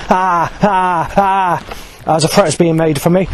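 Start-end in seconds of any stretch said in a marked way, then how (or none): 0.1-1.6 imitated crow cawing. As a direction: caw it like a crow. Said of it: "bird or crow goes".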